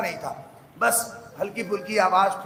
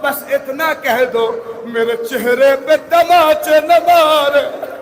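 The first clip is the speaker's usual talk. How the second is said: rising, slowing plea that swells louder; pleading; 4 semitones higher, 7 dB louder than usual. Retold or imitated. Sakina begging her brother, pleading louder and slower. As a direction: beg with folded hands, voice climbing. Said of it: "ہاتھ جوڑ کے کہتی تھی".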